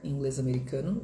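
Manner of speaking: usual speaking voice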